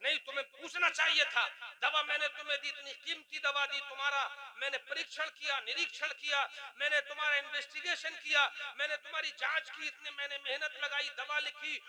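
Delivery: retold scolding that builds to a loud accusation; scolding doctor. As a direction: play a doctor berating his patient point by point. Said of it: "doctor को देखा मरीज को डांटते हुए".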